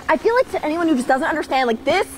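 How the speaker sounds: ordinary talk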